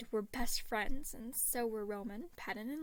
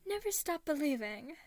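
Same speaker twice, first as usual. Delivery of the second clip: loud, higher, hammed-up vow; over dramatic declaration; 5 semitones higher, 5 dB louder than usual. A narrator's reading. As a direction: declare it like a hero. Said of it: "raising a fist over dramatically".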